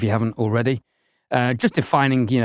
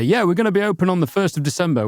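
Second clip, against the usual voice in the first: brisk, higher mock reply rattled off fast; quick imagined answer; 6 semitones higher, faster than usual. Host imitating the short answer he expected from Paul, brisk and high. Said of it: "I was thinking Paul would just say".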